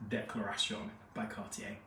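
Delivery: French pronunciation of Declaration and Cartier; French accent. Shown as a demonstration. Reviewer apologises before naming the perfume, in a French accent. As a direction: say the perfume name with French vowels and stress. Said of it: "say this in a French accent".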